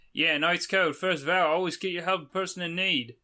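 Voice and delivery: badly faked hearty Australian; terrible Australian accent. Traveller pledging help to Shovel Knight, bad Australian accent. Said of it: "read this in a terrible accent".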